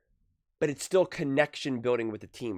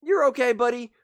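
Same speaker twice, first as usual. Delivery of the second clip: cheery reassuring parent; 7 semitones higher, 5 dB louder than usual. Retold or imitated. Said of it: "But if you go".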